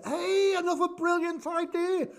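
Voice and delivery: delighted outburst; excited. Real, not acted. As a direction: announce the idea with a burst. Sudden idea while crafting.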